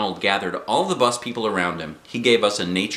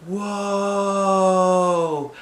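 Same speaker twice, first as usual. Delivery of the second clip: amazed; 10 semitones higher than usual. Acted. Junie B sees herself in the mirror, high and amazed. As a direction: gasp at your own reflection.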